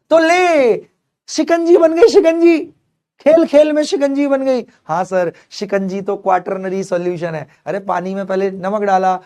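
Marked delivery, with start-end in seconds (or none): 0.1-4.7 excited reveal. Acted. Teacher reveals lemonade, excited.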